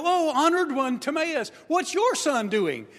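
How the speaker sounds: high, mock-polite greeting with a dig; mock honor. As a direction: sneer sweetly as you ask. Friends taunt Timaeus.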